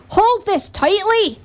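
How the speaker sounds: high, urgent Scottish call; Scottish accent yell. A narrator's reading. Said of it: "he yelled".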